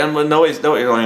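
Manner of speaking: ordinary delivery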